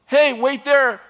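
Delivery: acted holler to people below; calling down. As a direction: call it out across a valley. Enemy calls down from mountain.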